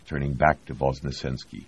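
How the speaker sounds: ordinary delivery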